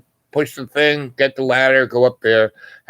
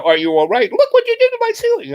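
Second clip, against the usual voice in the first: high, fast, loud outraged lady; shrieking homeowner; 3 dB louder, faster than usual. Retold or imitated. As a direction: screech it like the furious homeowner. Homeowner yells at him.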